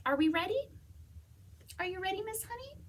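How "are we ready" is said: plain reading voice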